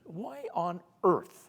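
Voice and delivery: slow, every word leaned on, WHY ON EARTH; slow, emphatic mock-scandalised question; drawn-out mock outrage.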